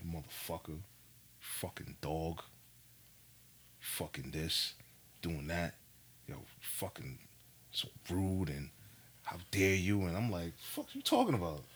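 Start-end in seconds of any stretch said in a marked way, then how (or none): 0.1-10.0 low, very soft retold grumbling; faint, spaced-out mock cursing; half-heard scolding. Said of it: "in my tenth dream, wake up, like, all I hear is". 10.6-11.6 just-woken confusion. Retold or imitated. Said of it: "I just woke up like".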